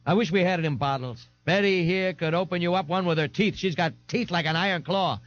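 put-on high-pitched tough girl; cheeky beer-girl swagger; brash, rowdy Frida. A narrator's reading. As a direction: taunt him in a high voice.

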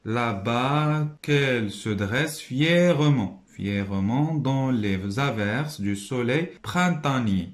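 Là-bas qu'elle se dresse fièrement read slowly and expressively; slow reading aloud. Shown as a demonstration. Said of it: "C'est là".

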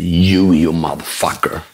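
lightly voiced low-pitched pronouncement; a hint of the speaker's deep delivery; imitating stage speaker's verdict. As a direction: drop low for a mock-blunt punchline. Quoting speaker's punchline answer.

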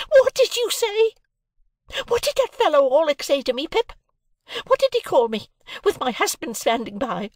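very high, loud shrieked questions; screamed outrage. A narrator's reading. Sister demands what Orlick called her, screaming. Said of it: "beginning to scream".

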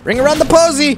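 sing-shout it gleefully. Real, not acted. Player tossing bombs, gleeful singsong shout.

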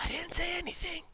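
high, unvoiced secretive whisper; mock whisper. Sneaky denial after gossip.

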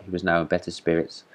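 ordinary delivery, nothing marked

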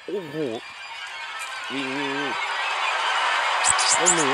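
high, loud urgent cries of run; frantic yelling. Real, not acted. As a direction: yell it while escaping for your life. Fleeing Granny.

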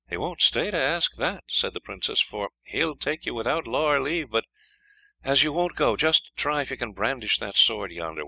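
high, earnest princess voice. A narrator's reading. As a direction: plead with him. Princess warns hero.

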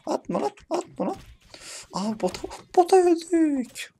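very high squealing cry; panicked squeal as the bot kills them; shrieking squeal. Real, not acted. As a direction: screech it in a cracked high voice. Voice breaks into squeal.

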